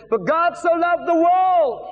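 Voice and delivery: high, drawn-out holler; street-preaching shout. Retold or imitated. New evangelist testing the verse outside a pub, shouting. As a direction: call it out slowly to the whole street. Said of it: "I did this".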